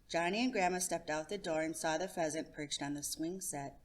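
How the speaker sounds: usual speaking voice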